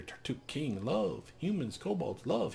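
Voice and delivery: mild fearful kobold babble; frightened quick kobold. Acted. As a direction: blurt the words while shielding yourself. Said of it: "He speaks quickly".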